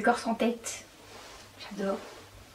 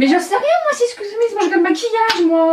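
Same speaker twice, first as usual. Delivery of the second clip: high, loud protest among friends; indignant protest; 10 semitones higher, 7 dB louder, much faster than usual. Real, not acted. To her friend about makeup, loud high protest.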